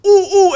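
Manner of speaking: high, loud bursts begging to be picked; eager kid's "ooh ooh". Retold or imitated. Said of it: "And he goes".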